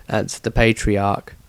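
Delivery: plain reading voice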